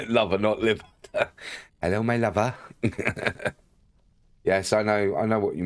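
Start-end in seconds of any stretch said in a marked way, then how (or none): 1.8-2.5 light stab at Bristolian; toned-down mock Bristolian greeting; Bristolian accent. Streamer correcting liver to lover, Bristolian accent. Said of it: "don't do a very good Bristolian accent".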